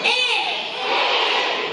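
very high, buzzy "Eh!" blasted open; bright obnoxious cartoon-kid "Eh!"; big bright obnoxious eh. Shown as a demonstration. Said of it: "That, that's not it".

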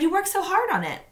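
a hint of a mom consoling; lightly voiced mother; sympathetic mother. A narrator's reading.

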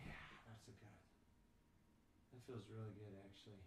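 soft, half-whispered relief; relieved murmur. Real, not acted. Dad melting into relaxation pose, softly relieved. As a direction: let it out as you sink into the floor.

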